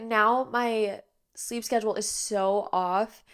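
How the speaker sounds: ordinary talk, nothing marked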